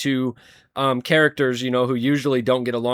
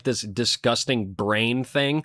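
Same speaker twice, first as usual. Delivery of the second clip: ordinary delivery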